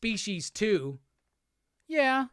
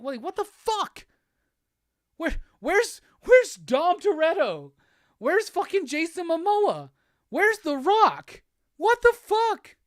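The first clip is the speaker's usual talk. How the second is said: high, rising, louder indignant yelps; outraged disbelief; 9 semitones higher, 5 dB louder than usual. Real, not acted. To the viewers, missing cards from the set, high outraged yelps. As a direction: demand where the stars went.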